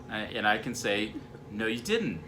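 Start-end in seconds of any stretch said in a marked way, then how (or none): 1.5-2.2 stubborn mock contradiction; defensive. Husband contradicts wife in escalating quarrel, defensive. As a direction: flatly deny it, a touch combative. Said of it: "just get defensive and say".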